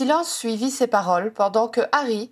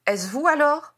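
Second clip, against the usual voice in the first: ordinary delivery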